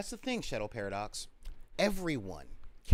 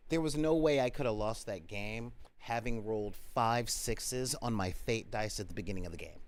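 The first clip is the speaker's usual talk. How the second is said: ordinary talk, nothing marked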